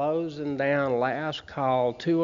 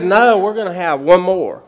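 insistent; 5 semitones higher, 11 dB louder than usual. Retold or imitated. Friend insists on another round.

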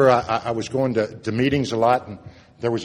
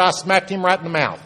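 Texas twang; 7 semitones higher, 5 dB louder than usual. Retold or imitated. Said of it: "he had his little twang".

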